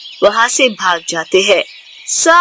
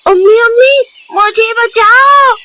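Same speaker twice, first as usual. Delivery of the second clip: crying out for mother; 10 semitones higher, 5 dB louder than usual. Acted. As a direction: wail for his mother to save him. Jugu calls mother for help.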